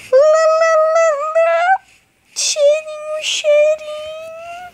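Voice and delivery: very high, slow squeaky cartoon voice; squeaky silly voice. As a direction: squeak it out like a toon. Silly high voice announcing video.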